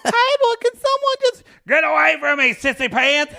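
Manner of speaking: squeaky high taunting voice; high mock voice. High put-on voice.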